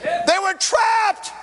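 slow, high, rising preacher's cry; full-force preaching. Acted. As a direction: drive it home like a revival preacher.

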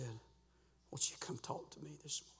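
soft, hushed call from the Spirit; still small voice. Acted. Preacher voices the Holy Spirit calling him to talk, hushed. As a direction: speak it softly, as an inner prompting. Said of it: "the Holy Spirit said".

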